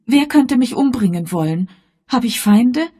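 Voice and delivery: high, loud, pressing questions; agitated daughter Elvira. Acted. Daughter challenging her mother about enemies, high and agitated.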